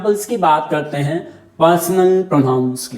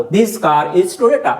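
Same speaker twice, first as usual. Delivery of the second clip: slow example; much slower than usual. Shown as a demonstration. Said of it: "demonstrate कर दिया car को".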